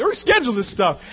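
high, loud exasperated demand; mad demand. Retold or imitated. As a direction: order it changed, hammed-up anger. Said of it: "I'm mad".

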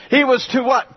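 ordinary delivery

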